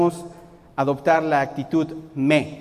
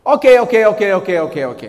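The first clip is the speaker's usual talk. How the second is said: exasperated okays; 2 semitones higher, 8 dB louder than usual. Pretend. A churchgoer giving in, annoyed and loud. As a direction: brush them off, irritated. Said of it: "Me conviene".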